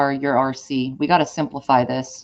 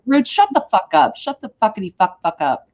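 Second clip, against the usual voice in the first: mock yelling; 8 semitones higher than usual. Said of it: "Yeah, like".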